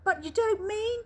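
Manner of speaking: put-on an old man dreading the answer; alarmed shout. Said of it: "shouted Grandpa Joe".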